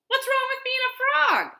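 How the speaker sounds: very high, loud indignant question; offended. A narrator's reading. Frogs arguing about the pig, high and indignant. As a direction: protest it in a high, affronted voice.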